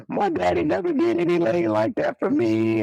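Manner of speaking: high, moved woman's voice, imitating; girlfriend's voice. Cousin's girlfriend voiced, crying.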